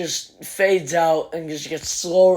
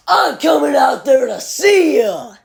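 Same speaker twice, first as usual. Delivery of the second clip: calling out; 7 semitones higher than usual. Retold or imitated. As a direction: sing it out to the crowd. Imitating singer calling to crowd.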